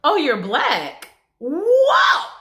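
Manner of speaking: stunned double take, voice jumping up; shocked man. Retold or imitated. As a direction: customer sees her for the first time, mock shocked. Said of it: "literally went".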